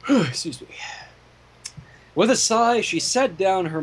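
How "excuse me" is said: hushed whisper, no voice at all; whispered apology. Real, not acted. Reader apologizing to the group in a whisper. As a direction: breathe it out as a sheepish aside. Apologizing quietly after a sigh.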